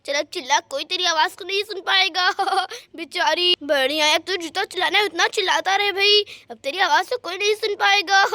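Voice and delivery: taunting villain with smug laughs; mock the helpless girl, laughing; gloating thief. Thief to the gagged girl nobody can hear, gloating.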